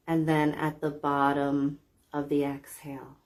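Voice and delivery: calm, measured relaxation voice with long pauses; guided meditation. Real, not acted. Guide leading listeners through belly breathing to relax, calm meditation voice. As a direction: guide them gently into deep, even breathing.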